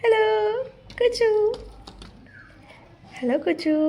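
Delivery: high sing-song greeting; calling a bird. Real, not acted. (পাখিকে আদুরে, সরু গলায় ডাকা)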